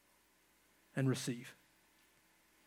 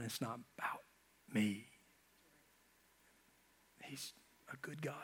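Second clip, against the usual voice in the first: much softer, dropping to a near whisper; lower, softer, whispered confession of faith; hushed prayer; 7 semitones lower, 16 dB quieter than usual. Pretend. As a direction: breathe it like a private prayer.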